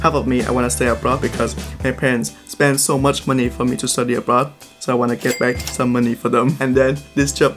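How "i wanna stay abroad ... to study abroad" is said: normal talking voice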